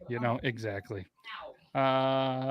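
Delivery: normal talking voice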